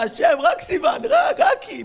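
high, pleading shout to God; desperate shouted prayer. Acted. Young man begging God for Sivan, high desperate shout. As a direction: cry out, begging for her.

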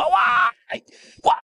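high, drawn-out mock howl of pain; mock pained yell. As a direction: act out a king yelping over his hurt finger. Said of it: "הוא התחיל לצעוק". (זעקת כאב מוצגת, גבוהה)

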